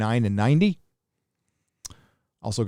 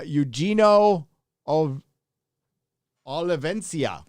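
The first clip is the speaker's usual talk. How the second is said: ordinary delivery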